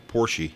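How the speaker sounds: "Porsche" as POR-shee; [name]'s pronunciation. Retold or imitated. Recalling a singer's version of the car name, "Porsche" as POR-shee. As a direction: end "Porsche" on a long ee. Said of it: "What was it [name] called it?".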